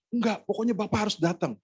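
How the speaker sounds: high-pitched firm demand; imitating a caller who won't be refused; insistent caller. Caller insists he must come.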